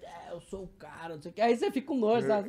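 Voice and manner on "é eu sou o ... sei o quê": a hint of mocked arrogance; lightly voiced cocky guy. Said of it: "quando é aqueles gordos marrentos".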